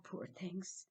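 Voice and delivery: whispered mock pity, poor things. A narrator's reading. Narrator sneering at the busy neighbours in a whisper. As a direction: breathe it with sly false sympathy.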